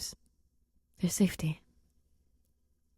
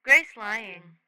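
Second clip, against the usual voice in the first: lightly raised, blunt alien voice; alien Rocky voice; 2 semitones higher, 6 dB louder than usual. Acted. Rocky calling out Grace's lie from the entrance, blunt alien voice. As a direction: state it flatly like a small alien. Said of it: "he hears Rocky's sudden voice".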